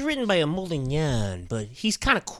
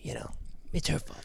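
whispered aside; 11 dB quieter than usual. Pretend. Conspiratorial aside.

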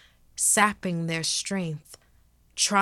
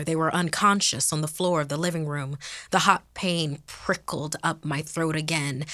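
ordinary delivery, nothing marked

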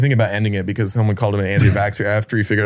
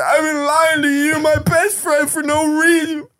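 high, slow hammed-up wail; mock crying; slower than usual. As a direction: wail it like a drunk crying friend. Drunk crying friend confesses.

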